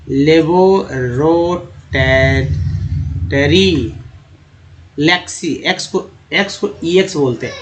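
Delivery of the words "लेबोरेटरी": लेबोरेटरी very slowly, as ले-बो-रे-टरी; syllable-by-syllable pronunciation. Shown as a demonstration. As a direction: pause between the parts of लेबोरेटरी. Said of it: "कैसे पढ़ा जाता है".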